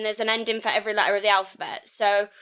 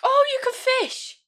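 high-pitched burst of delight; excited exclamation; 4 dB louder than usual. Real, not acted. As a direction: exclaim it high, like a thrilling discovery. Exclaims over fishing in game.

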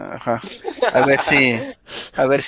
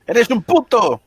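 excited; 10 semitones higher, 4 dB louder, much slower than usual. Real, not acted. Reacting to a failed roll.

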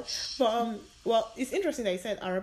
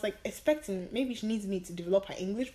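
normal talking voice

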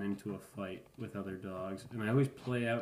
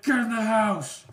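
angry dad yell; 13 semitones higher, 11 dB louder than usual. Retold or imitated. Father ordering his kids inside, furious loud yell. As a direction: roar the kids back indoors.

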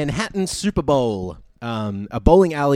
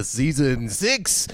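normal talking voice

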